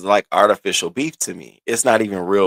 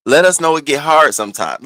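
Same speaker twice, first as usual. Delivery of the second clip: ordinary delivery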